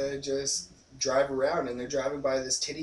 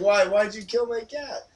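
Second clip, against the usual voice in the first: put-on girlfriend voice; 6 semitones higher than usual. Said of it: "she's like".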